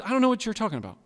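high, unforced brush-off; mild denial. Acted. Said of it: "not just".

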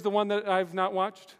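ordinary delivery

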